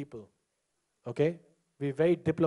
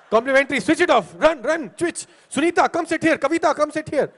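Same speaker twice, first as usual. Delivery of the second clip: high, loud rapid-fire orders; loud mock-frantic summons; urgent calling out; 9 semitones higher, 7 dB louder than usual. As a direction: shout across the room to hurry people in. Acting out calling people to seats.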